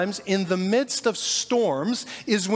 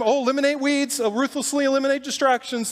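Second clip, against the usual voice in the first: smug preachy; 7 semitones higher, faster than usual. Retold or imitated. Disciples lecturing the crowd.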